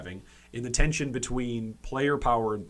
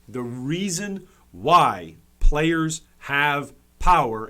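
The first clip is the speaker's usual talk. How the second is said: slow, weighty stress on "reason why players have power"; deliberate emphasis; 6 dB louder, much slower than usual. Real, not acted. Streamer explaining who caused power creep, deliberate emphasis. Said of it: "I'm just trying to emphasize here".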